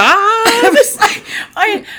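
high, slow, laughing through it; breaking up laughing. Real, not acted. Laughing at the stripper's clacking.

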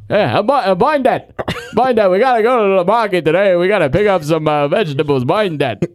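put-on thick South Philly old-man voice; Uncle Joe. Uncle calling his wife to go to market, thick Philly accent. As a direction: imitate an old husband's sing-song Philly mumble. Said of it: "He's like".

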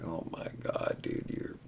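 whispered in disbelief; awed whisper. Retold or imitated. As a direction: breathe it out, stunned. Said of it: "I'm like".

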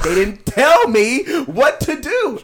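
whiny excuse. Retold or imitated. Man excusing himself to the judge, slow and whiny. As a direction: whine the excuse. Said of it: "and he's like".

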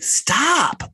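squeaky high stop, pleading; high imitated plea to stop; high mock plea. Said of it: "I was like".

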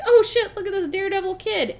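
high, quick burst of mock amazement; excited Johnny. Acting out Johnny seeing the video, excitedly. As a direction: blurt it out, imitating an excited onlooker. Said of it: "And Johnny was like".

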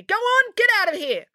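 high-pitched irate dismissal; snappish, very high order to leave; snapped. A narrator's reading. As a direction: shoo them off angrily. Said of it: "snapped the cat lady".

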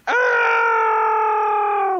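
mock terrified scream of a trapped man; high scream. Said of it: "he's like".

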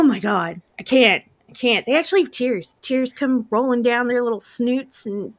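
ordinary talk, nothing marked

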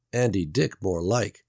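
ordinary delivery

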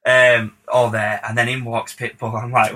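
ordinary delivery

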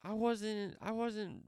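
high-pitched, stammered self-defence; exasperated protest. Retold or imitated. Man answering the screaming lady in the deli, exasperated.

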